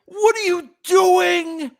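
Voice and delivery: high, slow disbelieving wail; incredulous cry. Real, not acted. Host reliving the wild ending to viewers, disbelieving cry.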